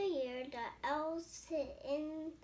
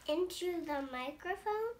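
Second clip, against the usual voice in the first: usual speaking voice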